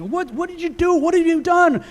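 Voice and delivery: high, outraged shout of a furious dad; angry father. Retold or imitated. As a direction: demand it like a shocked, angry father. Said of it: "He's all mad".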